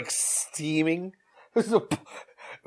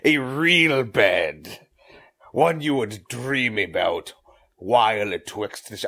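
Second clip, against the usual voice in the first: normal talking voice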